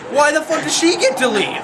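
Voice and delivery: high, indignant complaint. Real, not acted. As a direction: complain at the unfairness. Indignant at unfairness.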